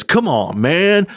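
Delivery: drawn-out come-on-man, pitch swooping high on "man"; mock catchphrase with a swooping last word; Joe Biden catchphrase. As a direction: lightly mimic a politician's pet phrase, rising high at the end. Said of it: "and he says".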